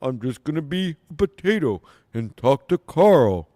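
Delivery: dopey, lazy mock voice of a dull performer; lightly put-on dim potato voice; a hint of a dull, dopey comic mocked; mocking impression of Cardiff. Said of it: "Cardiff stinks".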